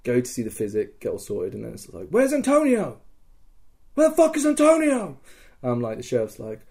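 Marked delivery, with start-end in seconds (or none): 2.1-5.2 very high shouted-style question, building; retold frantic demand. Player finds Antonio missing, escalating high demand. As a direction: call out, then push harder.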